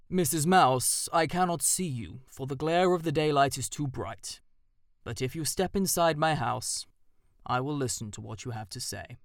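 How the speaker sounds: stately invitation with a hint of menace; owl voice. A narrator's reading. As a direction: owl inviting the mouse into his hollow.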